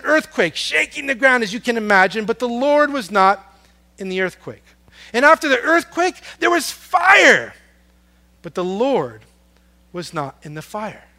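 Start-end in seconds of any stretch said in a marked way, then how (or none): 5.1-7.5 building to a shout. Acted. Preacher telling the fire at Elijah's cave, building to a shout. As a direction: build it up until fire booms out.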